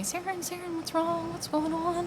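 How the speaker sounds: put-on high, soft small-child voice; little sister. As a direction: ask like a worried little girl, high and quiet. Five-year-old sister asking, retold.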